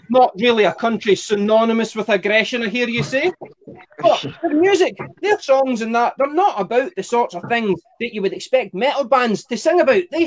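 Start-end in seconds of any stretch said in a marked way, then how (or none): none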